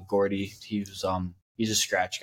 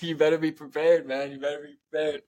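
a taunting heads-up before the golf match; mock warning; 5 semitones higher than usual. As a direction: warn him teasingly in a put-on voice. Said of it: "I was like".

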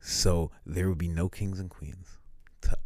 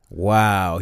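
ordinary talk

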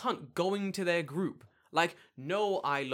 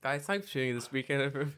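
ordinary delivery

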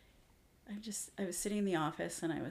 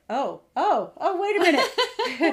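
high, laughing, slowed double take; a mock double take, laughing; startled older brother; 10 semitones higher, 12 dB louder, slower than usual. Thirteen-year-old realizing little brother passed him, laughing alarm. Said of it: "the thirteen-year-old's like".

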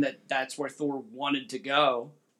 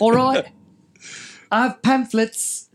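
put-on New Zealand accent; 8 semitones higher, 5 dB louder, slower than usual. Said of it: "pardon my horrible New Zealand accent".

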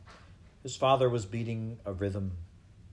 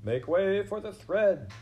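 high, slow, loud announcement; mouse crying out; 11 semitones higher, 5 dB louder than usual. A narrator's reading. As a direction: call out to clear a path, stretched high. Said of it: "cried a mouse".

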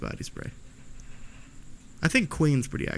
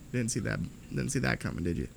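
normal talking voice

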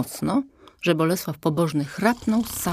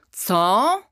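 indignant outburst; 5 semitones higher, 4 dB louder than usual. Acted. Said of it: "uniósł się król".

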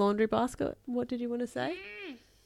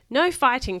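higher, mildly raised storybook call; firm acted cry, pitched up; 5 semitones higher, 5 dB louder than usual. A narrator's reading. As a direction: call out to break up a fight. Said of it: "cried Brock".

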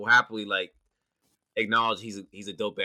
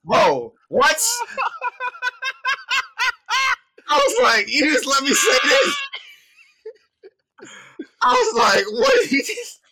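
very high, loud squeal breaking into laughter; crazy incredulous outburst; 9 dB louder, slower than usual. Retold or imitated. Co-host reliving hearing his own recording, crazy laughing shout. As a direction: shriek it in comic disbelief. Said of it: "I sound crazy".

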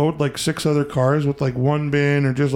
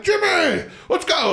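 high, eager shout-out to a crewmate; enthusiastic call-out; slower than usual. Retold or imitated. Driver hailing Jimmy on the run, enthusiastic. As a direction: call him along, hammed-up and keen. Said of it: "I'm all enthusiastic".

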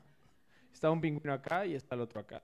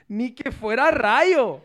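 slow punchline through a laugh; 5 semitones higher, 13 dB louder, much slower than usual. Acted. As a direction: drag out the pun while laughing. Remate del chiste, riendo. (remate lento entre risas)

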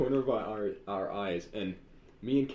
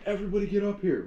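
put-on teacher calling kids; 8 semitones higher, faster than usual. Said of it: "Mister Sokolic yells".

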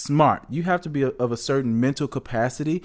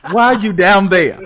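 louder, higher playful heckle; teasing shout; 5 semitones higher, 10 dB louder than usual. Retold or imitated. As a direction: hype the singer up.

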